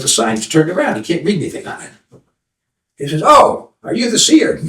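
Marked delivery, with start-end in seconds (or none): 3.2-4.6 high, drawn-out wondering question; eager young seeker. Acted. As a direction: ask it high and slow, in hope. Young seeker greets the seer.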